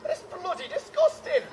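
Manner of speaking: ordinary talk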